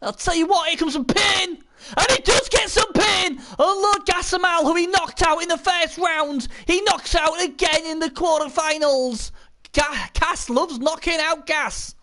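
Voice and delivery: high-pitched live game commentary; excited play-by-play. Real, not acted. Commentator calls Cass knocking out Gas, excited play-by-play.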